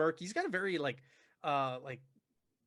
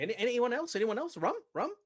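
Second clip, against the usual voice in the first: hearty offer; 8 semitones higher, 5 dB louder than usual. Acted. Larkin offers drinks round table.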